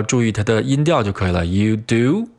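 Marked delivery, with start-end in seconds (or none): 1.4-2.3 "You do?" lifting at the end as a question; rising tune. Shown as a demonstration. Teacher contrasting tunes, "You do?" with questioning rise. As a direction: let "do" climb in a questioning rise. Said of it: "一个是升调，表示疑问".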